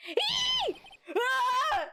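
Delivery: high startled squeal and gasp. Acted. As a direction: squeal then gasp in fright. Startled by Gumshoe.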